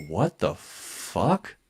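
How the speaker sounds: slow, hushed, baffled thought; inward disbelief. Retold or imitated. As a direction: say it slowly under your breath, dumbfounded. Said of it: "in my head is what I'm thinking".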